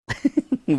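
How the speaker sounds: brief high-pitched giggle; real short burst of laughter; short chuckle. Real, not acted. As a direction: let out a quick amused laugh. Amused again by the test result.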